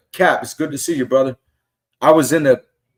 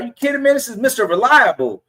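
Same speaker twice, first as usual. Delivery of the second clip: excited disbelief; 8 semitones higher than usual. Retold or imitated. Fan gushing to player.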